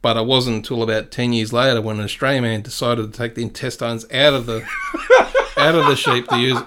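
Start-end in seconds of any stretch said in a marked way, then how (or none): 4.1-6.6 cracking up at own joke; laughing through words. Real, not acted. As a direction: struggle to get the punchline out through laughter.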